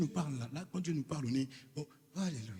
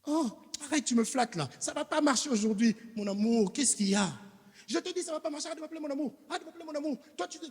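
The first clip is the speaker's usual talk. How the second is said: higher-pitched, louder mock wife brushing him off; annoyed wife; 10 semitones higher, 3 dB louder, faster than usual. Wife rejecting her husband's sweet talk, higher, exasperated. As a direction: play the fed-up wife refusing the flattery.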